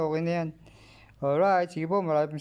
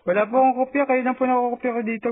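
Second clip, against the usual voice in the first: higher, raised on-air reply; radio operator voice; 6 semitones higher, faster than usual. Acted. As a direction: speak up into the mic.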